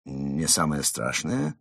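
low, slow, offended question; hurt. Acted. Mole echoing fox's words back, hurt. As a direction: echo it slowly, rising, with injured feelings. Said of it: "переспросил Крот с обидой".

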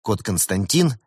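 ordinary delivery, nothing marked

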